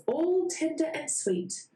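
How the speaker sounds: usual speaking voice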